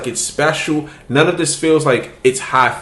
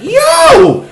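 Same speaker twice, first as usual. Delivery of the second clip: much higher, louder alarmed yelp; alarmed excitement; 13 semitones higher, 5 dB louder than usual. Retold or imitated. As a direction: yelp as the hate piles on. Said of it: "I'm like".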